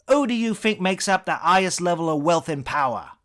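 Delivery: explosive high British shout into a tirade; Eric Idle. Acted. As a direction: cut them off yelling, then lecture in a British accent. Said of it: "impression of Idle's Cambridge-tinged inflections".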